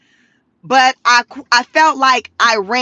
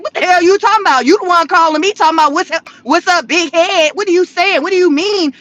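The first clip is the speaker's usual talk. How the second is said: higher, rapid-fire comic comeback; mock indignant retort; 6 semitones higher, faster than usual. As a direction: fire back like an accused ex, playfully. Acted spat between reunited exes.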